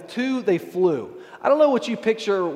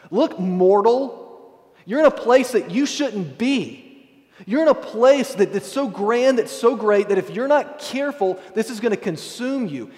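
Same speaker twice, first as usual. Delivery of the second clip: seraph's warning; 4 semitones higher than usual. Acted. Fiery seraph warns the mortal in the throne room, stern warning. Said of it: "as a warning".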